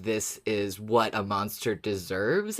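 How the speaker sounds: normal talking voice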